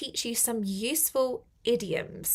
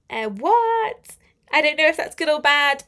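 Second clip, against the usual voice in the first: puzzled surprise; 4 semitones higher, 8 dB louder than usual. Real, not acted. Puzzled by a comment.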